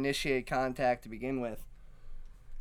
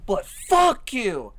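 slow, loud, higher yell of "fuck you"; retold angry outburst; 7 semitones higher, 7 dB louder, slower than usual. Drunk woman yelling at the man, angry mock yell. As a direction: bark it out like the drunk woman turning on him. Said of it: "and she goes".

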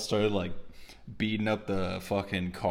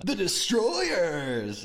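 slow, higher shouted title; announcer shout; 7 semitones higher, much slower than usual. Retold or imitated. Imitating the show announcing its team, slow shout. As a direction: call it out like a TV intro. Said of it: "they're always like".

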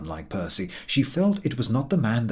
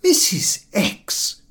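offended dignity; 11 semitones higher, 5 dB louder, much slower than usual. A narrator's reading. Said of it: "drawing herself up to her full height".

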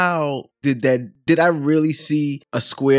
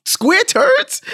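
high, incredulous squeak; baffled; 4 dB louder than usual. Retold or imitated. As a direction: squeal it in disbelief. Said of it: "I'm just like".

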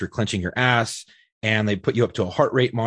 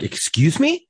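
offended surprise; 10 semitones higher, 3 dB louder, slower than usual. Retold or imitated. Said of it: "I was like".

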